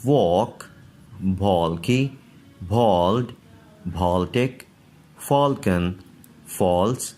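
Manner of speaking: words with aw before L, read one by one; teacher reading a word list; model pronunciation. Shown as a demonstration. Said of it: "वैसे ही पढ़ेंगे". (शब्द-सूची का साफ़ उच्चारण)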